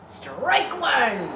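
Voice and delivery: loud, high playground call; yelled. Acted. Mary calling a strike to Harry, loud yell. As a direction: shout it across the field. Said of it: "Mary yelled".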